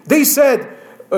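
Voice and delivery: ordinary talk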